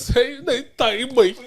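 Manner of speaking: playful Bajan impression with a laugh; mock Bajan accent. Shown as a demonstration. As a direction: demonstrate a Bajan accent, high and amused. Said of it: "Do it like that".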